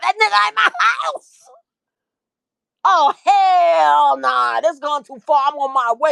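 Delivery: high, loud slow outrage; outraged. Retold or imitated. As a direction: explode at the news. Outraged on the phone.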